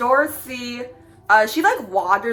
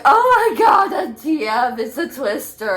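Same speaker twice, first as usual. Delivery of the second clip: put-on Brooklyn accent; 3 semitones higher than usual. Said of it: "In your Brooklyn accent".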